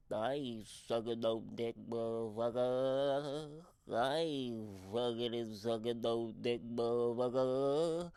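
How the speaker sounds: woman on the bus muttering eerily to herself; creepy mumbling woman. Retold or imitated. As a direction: mutter it like a horror film ghost. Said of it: "But like a horror show".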